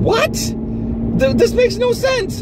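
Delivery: disbelieving squeak at the screen; reliving his confused reaction while watching; baffled. Retold or imitated. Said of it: "I was just, like, going".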